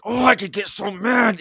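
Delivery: high, loud mock outrage; hammed-up angry outburst; imitated anger. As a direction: act out someone getting furious. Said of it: "he's so mad".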